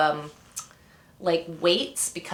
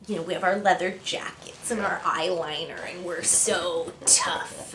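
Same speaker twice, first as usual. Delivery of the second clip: mock tough; 2 semitones lower, 6 dB quieter than usual. Singer joking about the band's leather-jacket image, mock tough. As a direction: drag it out with joking tough-band swagger. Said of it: "the joke in Dirty Jeans was that".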